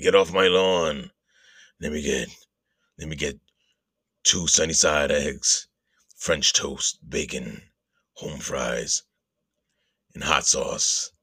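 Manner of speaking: put-on low, gruff old-man voice; dropped low and terse, cranky old man; Clint Eastwood growl. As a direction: imitate a grumpy Gran Torino Eastwood ordering breakfast. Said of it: "he's like Clint Eastwood in Gran Torino".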